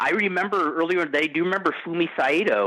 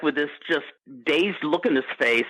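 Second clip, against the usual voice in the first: usual speaking voice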